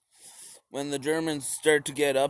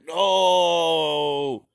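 mock Stalin caveman; much slower than usual. Stalin dismissing his generals, slow and dumb. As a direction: drag it out like a brute. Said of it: "And Stalin was like".

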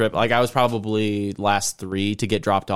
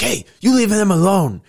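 put-on high, louder kid voice defending a friend; mock shouting kid; 11 semitones higher, 5 dB louder than usual. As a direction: play the brave little friend yelling at bullies. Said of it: "He's like".

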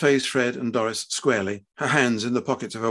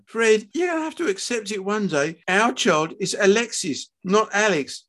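high-voiced wife standing firm; insistent wife; 9 semitones higher than usual. A narrator's reading. Wife urging her husband to accept their child, insistent. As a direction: urge him, voice raised in pitch.

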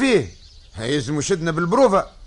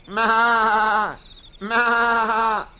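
bleating like a goat; a drawn-out goat bleat, maa maa; 4 semitones higher, much slower than usual. Shown as a demonstration. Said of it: "نقلدوا له صوت ماعز".

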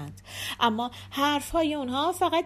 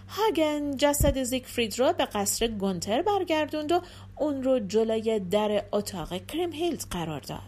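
ordinary delivery, nothing marked

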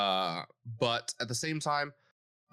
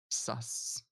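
whispered; 6 dB quieter than usual. Real, not acted. Teasing co-host.

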